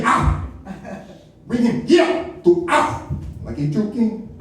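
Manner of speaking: exaggerated African accent, slow and high; W'Kabi. Shown as a demonstration. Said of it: "You gotta say that shit from here".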